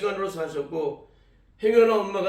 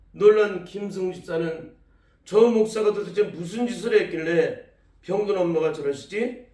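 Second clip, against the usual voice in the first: normal talking voice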